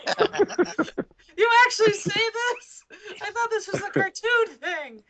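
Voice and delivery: high, loud mock-astonished squeal; over-the-top squeaky shock, laughing; incredulous retold reaction. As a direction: act out hammed-up disbelief, breaking into laughter. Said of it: "And I was like".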